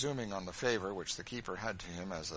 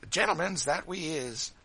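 lively Sambo; 8 semitones higher, 7 dB louder than usual. A narrator's reading. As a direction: crow mockingly to an audience of boys. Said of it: "said Sambo".

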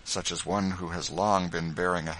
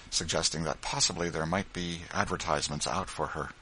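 ordinary delivery, nothing marked

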